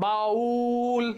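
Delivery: "বাউল" with the উ fully drawn out; fully pronounced. Shown as a demonstration. Teacher showing students the full উ, "বাউল" drawn out. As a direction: hold the উ in "বাউল" long and level. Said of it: "পরিপূর্ণভাবে উচ্চারণ হচ্ছে".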